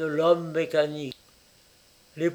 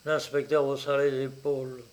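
ordinary delivery, nothing marked

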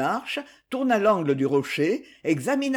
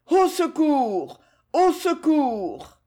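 high, long drawn-out distress call; cry for help; 9 semitones higher, much slower than usual. A narrator's reading. Pierre, alone on the mountain, calls for help, high drawn-out cry. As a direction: slowly holler for help, pitched high. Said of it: "il se décida à crier".

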